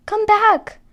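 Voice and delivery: high, loud shout after her; calling out. A narrator's reading. Startled prince calling after fleeing Cinderella. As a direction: call after someone running away. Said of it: "cried the startled prince".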